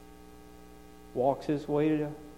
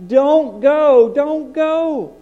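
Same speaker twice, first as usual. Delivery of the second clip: pleading cry; 12 semitones higher, 10 dB louder, much slower than usual. Retold or imitated. People begging Paul to stay.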